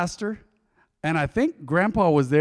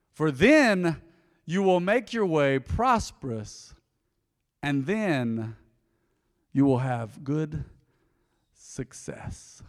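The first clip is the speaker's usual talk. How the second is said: slow, weighty verse with pauses, sinking softer; slow solemn reading; 3 semitones lower, 4 dB quieter, much slower than usual. Real, not acted. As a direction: let each phrase land, down to a hushed "success". Reads verse slowly for weight.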